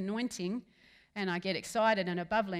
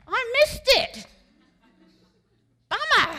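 high, loud mock wail of regret; hammed-up shout of dismay; dismayed cry; 13 semitones higher, 13 dB louder, slower than usual. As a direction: cry out as if you just blew it. Acted dismayed cry in heaven.

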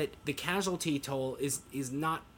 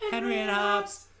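silly voice; 8 semitones higher, 4 dB louder than usual. Pretend. Repeating show name in voices.

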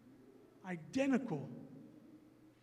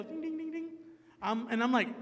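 ordinary talk, nothing marked

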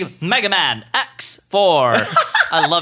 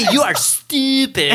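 higher cartoon boy-genius scolding with an odd foreign accent; laughing imitation of a cartoon character berating someone; Dexter; 7 semitones higher than usual. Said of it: "It's, it's Dexter".

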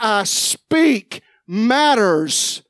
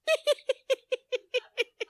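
high, wild mock laugh; high hooting laugh, like the hyena he remembers; 6 dB quieter than usual. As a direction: cut loose like a hyena.

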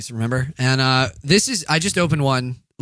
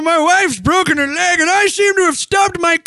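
high, strained mock plea for help; loud hammed-up old man on an emergency call; injured old caller; 14 semitones higher, 5 dB louder than usual. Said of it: "Can you imagine the call?".

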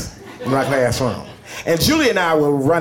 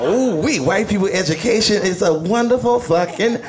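put-on smug kid laughing; 7 semitones higher than usual. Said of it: "I'm like".

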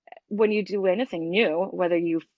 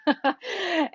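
short laugh; 10 semitones higher than usual. Real, not acted. Laughing after admitting imperfection.

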